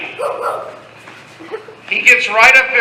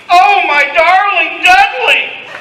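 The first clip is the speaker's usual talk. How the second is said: high, hammed-up damsel's cry; mock scream; 7 semitones higher than usual. A narrator's reading. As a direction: cry out like a melodrama heroine. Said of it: "stands up and screams".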